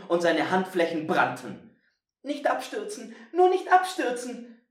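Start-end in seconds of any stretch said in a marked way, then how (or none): 2.2-3.1 put-on tense, high boy, straining to hold; clinging on, urging himself; hanging on for dear life. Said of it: "schoss es ihm durch den Kopf". 3.3-4.6 louder, higher panicked plea; begging himself not to fall. Acted. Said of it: "schoss es ihm durch den Kopf".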